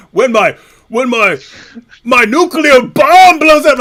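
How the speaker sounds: loud, slow, puffed-up mock brag; pompous mock boast. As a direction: inflate it like a cartoon villain. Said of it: "when he's like".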